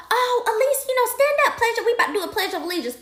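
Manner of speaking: high-pitched pre-K teacher voice; gently urging teacher, high voice; coaxing teacher. Retold or imitated. Teachers coaxing child, retold.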